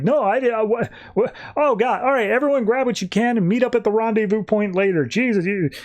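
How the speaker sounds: high, stammering mock panic; panicked flustered retelling. Henry to the crew after the gun goes off, mock panic.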